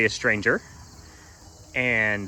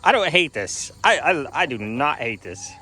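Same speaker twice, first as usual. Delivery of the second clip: excited; 8 semitones higher, 3 dB louder than usual. Real, not acted. Excited over goats and chickens.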